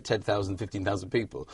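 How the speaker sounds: ordinary talk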